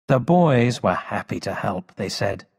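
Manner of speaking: ordinary delivery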